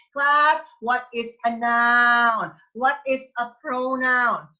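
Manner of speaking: loud, slow grade-school teacher voice; exaggerated teacher quizzing young pupils; elementary teacher. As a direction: drill basics to a class, loudly and slowly. Mimics a basic grammar class.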